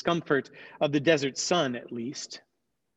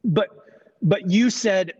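flustered stammer, higher and louder; 5 semitones higher, 6 dB louder than usual. Acted. Jonah caught out protests to God, stammering louder. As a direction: trip over the words, caught out. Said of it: "Jonah stammered".

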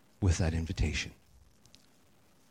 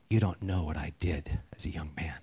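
low, softer confiding; leaning-in confession; 6 semitones lower, 6 dB quieter than usual. Retold or imitated. Man at bar confessing to preacher, low hushed.

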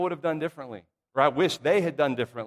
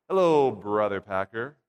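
formal greeting; 6 semitones lower than usual. Shown as a demonstration. Congregant greeting the preacher the old way, very formal. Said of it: "Make it very formal".

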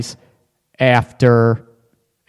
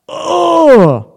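high, drawn-out mock "oh"; dismayed oh; 13 semitones higher, 5 dB louder than usual. Host reacting to a controlling partner's demand, dismayed. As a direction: let out a loud, high oh of dismay.

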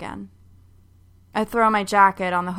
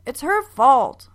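put-on whiny boy blaming; 6 semitones higher, 6 dB louder, slower than usual. Boy blaming sister in quarrel.

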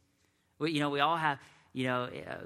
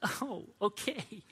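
mock taken aback; 9 semitones higher, much slower than usual. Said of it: "it's like".

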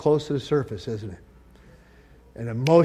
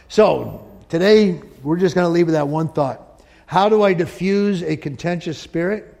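ordinary talk, nothing marked

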